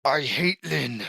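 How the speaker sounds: strained grumble of hatred; bitter resentment. Acted. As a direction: grumble like a sore loser. Beaten sibling's bitter remark.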